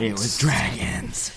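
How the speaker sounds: slow spooky whisper. Pretend. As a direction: whisper it slowly like a ghost story. Spooky joke echoing a friend.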